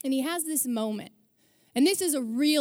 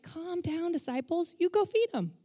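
soft, higher, unbothered reassurance; calm Jesus; 5 semitones higher, 4 dB quieter than usual. Retold or imitated. Jesus calming worried disciples, soft and high. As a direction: hush the panicking disciples gently. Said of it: "Jesus just is like".

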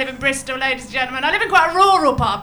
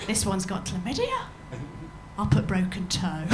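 lower, hushed confiding; hushed gossip; 6 semitones lower, 7 dB quieter than usual. Retold or imitated. Colleague murmuring while faking patient notes. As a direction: confide it under the breath.

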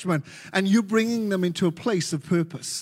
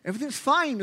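frantic denial; 7 semitones higher than usual. Shown as a demonstration. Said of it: "Okay, really frantic, insecure, neurotic".

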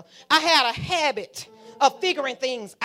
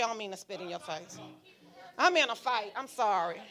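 ordinary delivery

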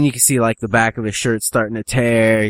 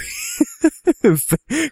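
very high drawn-out squeal; mimicked piercing squeals; imitated pig squeal.

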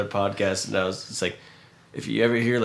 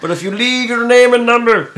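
high, loud mock voicemail greeting; silly answering machine voice; 13 semitones higher, 10 dB louder than usual. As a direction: put on a goofy voicemail voice. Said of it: "he's like".

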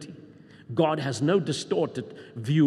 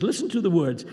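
normal talking voice

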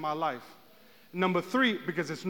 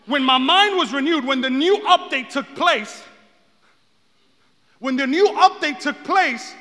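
raised, loud preacher's voice; full-force preaching; 7 semitones higher, 8 dB louder than usual. Real, not acted.

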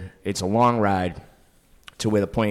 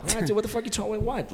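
baffled; 12 semitones higher, 4 dB quieter than usual. Retold or imitated. His own baffled retort.

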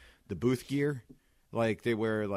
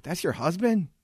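incredulous retold question, higher and louder; surprised; 9 semitones higher, 5 dB louder than usual. Customer learning she is married to the host, incredulous. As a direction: act out the guy's disbelief. Guy shocked she's married.

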